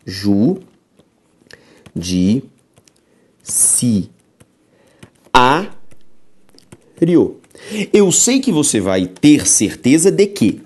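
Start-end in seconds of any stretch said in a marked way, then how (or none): 0.0-7.4 Ju-di-ci-a-rio, each syllable split very slowly; teacher splitting Ju-di-ci-a-rio into syllables. Shown as a demonstration. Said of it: "Se fazemos a separação silábica".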